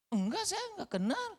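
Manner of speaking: high-pitched, insistent denial; panicked denial. Acted. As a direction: brush off the accuser in a hurry. Peter denies knowing Jesus.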